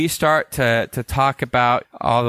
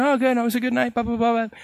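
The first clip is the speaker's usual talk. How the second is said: casual buddy reply; 10 semitones higher, faster than usual. Retold or imitated. Buddy's side of call.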